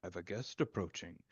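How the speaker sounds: soft, low-key character murmur; hushed, intrigued aside to oneself; villain musing privately. Acted. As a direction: muse quietly to yourself as you watch. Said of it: "he looks at it and he goes".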